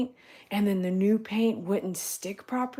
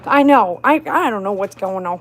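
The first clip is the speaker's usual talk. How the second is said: ordinary delivery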